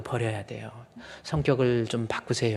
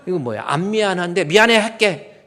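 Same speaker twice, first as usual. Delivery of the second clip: loud, higher mock sulk; sulky fake apology; 6 semitones higher, 9 dB louder than usual. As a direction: grudgingly fling the apology. Mocking grudging apology. (억지로 하는 투덜대는 사과)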